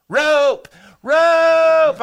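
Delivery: one-word holler, high and loud; loud, high practice shout; trial yell. Retold or imitated. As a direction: belt it out as a distress call. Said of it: "I'll yell, 'Rope.'".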